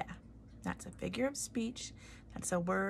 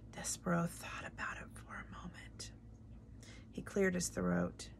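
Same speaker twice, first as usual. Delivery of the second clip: plain reading voice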